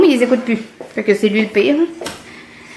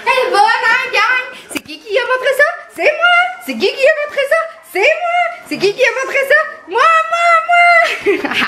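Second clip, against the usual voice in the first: excited high cheer. Real, not acted. Cheering the dog after a trick.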